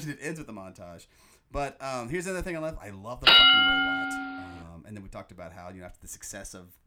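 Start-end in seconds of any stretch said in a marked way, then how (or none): 2.8-4.1 loud burst on fucking; loud gush. Real, not acted. Fan gushing about the robot, loud. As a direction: blurt out your love for it.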